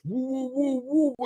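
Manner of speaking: high woo woo of bubbling sludge; mock gurgling noise. Telling the drain story, high mock bubbling woos. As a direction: copy the sound of stuff bubbling up.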